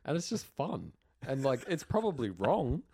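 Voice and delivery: ordinary delivery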